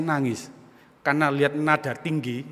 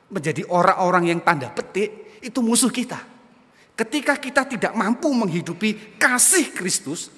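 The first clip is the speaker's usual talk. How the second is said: raised, insistent sermon delivery; emphatic preaching; 5 semitones higher, 4 dB louder than usual. Real, not acted. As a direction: drive each point home to the congregation. Preaches with rising force.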